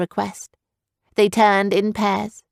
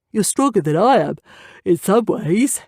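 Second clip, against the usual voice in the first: yawning through the words; 3 dB louder than usual. A narrator's reading. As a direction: say it mid-yawn, sleepy. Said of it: "yawning bigger this time".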